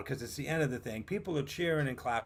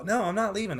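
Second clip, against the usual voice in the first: higher, louder stubborn no; defiant refusal; 7 semitones higher, 5 dB louder than usual. Retold or imitated. To his friend, a stubborn higher louder refusal. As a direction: dig in and refuse to leave.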